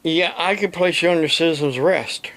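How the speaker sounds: ordinary talk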